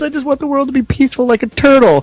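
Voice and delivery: high, teary imitation of a gentle big guy; crying hippie voice. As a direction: whine it like a sobbing peace-lover. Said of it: "he goes".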